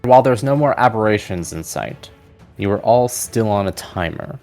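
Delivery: ordinary talk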